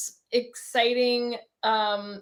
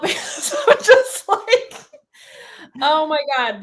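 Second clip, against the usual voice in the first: excited, louder, breaking into real laughter; giddy freak-out; 6 semitones higher, 7 dB louder than usual. Real, not acted. Host admitting she's freaking out over the potential, giddy and laughing. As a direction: gush and laugh, overwhelmed with excitement. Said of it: "kind of freaking out".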